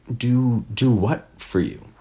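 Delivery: usual speaking voice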